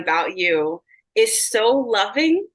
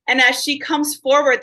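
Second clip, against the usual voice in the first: ordinary talk, nothing marked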